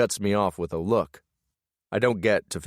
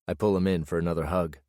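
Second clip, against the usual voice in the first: ordinary delivery, nothing marked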